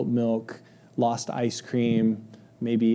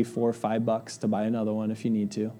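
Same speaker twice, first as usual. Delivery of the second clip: usual speaking voice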